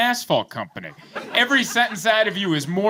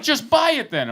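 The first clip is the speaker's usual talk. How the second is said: mock exasperated mom; 9 semitones higher than usual. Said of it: "she started yelling".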